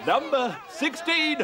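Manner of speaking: shouted bingo call, slow; bingo caller. Pretend. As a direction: bellow the bingo number gleefully. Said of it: "if I could have a go at calling".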